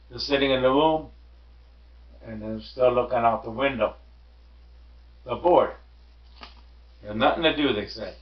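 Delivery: ordinary delivery, nothing marked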